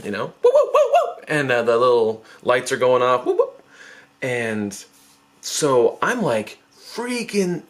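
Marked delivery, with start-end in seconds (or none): 0.3-1.2 high whooping siren imitation; police siren. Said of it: "you hear the siren". 3.2-3.6 short high siren whoops; police siren. Retold or imitated. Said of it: "you hear the siren".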